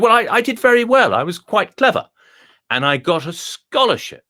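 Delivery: a high, plummy Etonian dog, pleased with itself; the dog boasts in a high, refined voice; posh dog voice. Acted. Telling the visitor about his schooling, high and plummy. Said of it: "That's why I learned to speak like this".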